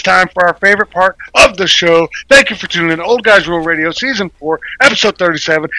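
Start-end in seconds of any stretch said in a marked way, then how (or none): none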